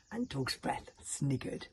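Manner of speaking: ordinary delivery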